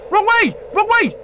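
high, loud panicked yell; panicked shout. Real, not acted. Lost while being chased.

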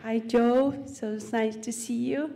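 tearful, laughing through it; just stopped crying, still emotional; after crying. Real, not acted. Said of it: "I have been crying so much".

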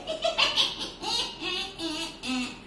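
high, stuttering cat snicker; cat noise. Acted. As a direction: imitate a cat reacting in little bursts. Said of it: "The cat is like".

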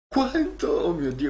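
surprised. Real, not acted. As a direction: jump up in disbelief. Sees huge download size.

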